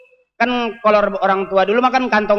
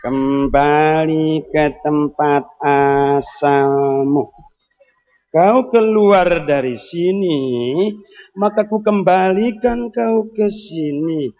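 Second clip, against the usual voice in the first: slow, drawn-out spell chanting in a lower voice; mock incantation; 5 semitones lower, much slower than usual. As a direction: chant it like a shaman casting a spell. Repeat of the incantation.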